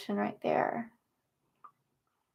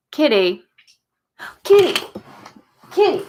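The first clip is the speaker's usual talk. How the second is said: loud high call to the cat; calling the cat across the room; 5 semitones higher, 10 dB louder than usual. Real, not acted. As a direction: call out for the kitty, pitched up.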